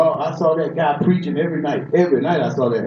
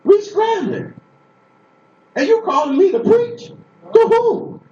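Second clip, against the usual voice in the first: incredulous protest; 14 semitones higher than usual. Retold or imitated. Preacher voicing his protest to God's call, incredulous. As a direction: protest that you're not fit.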